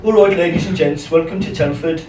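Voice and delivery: lightly imitated Indian-Brummie train announcer; terrible Indian Brummie accent. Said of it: "I'll apologise for my terrible Indian Brummie accent".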